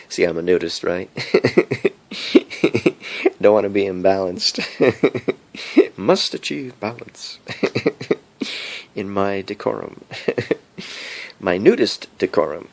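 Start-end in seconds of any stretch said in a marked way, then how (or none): none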